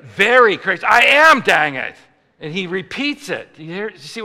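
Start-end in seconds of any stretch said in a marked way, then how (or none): 0.0-0.8 "Very" punched loud; stress on very. Shown as a demonstration. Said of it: "He's stepping it up". 0.9-1.9 loud, high outburst of frustration; exasperated protest. Acted. Acted protest to repeated command.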